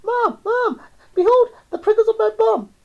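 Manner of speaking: very high, loud cry to Mum; shouting child. A narrator's reading. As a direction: shriek for Mum in alarm. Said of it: "She shouted".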